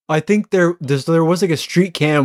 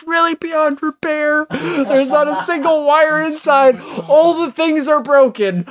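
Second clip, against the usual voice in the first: mock grief; 9 semitones higher than usual. Mock mourning the robot.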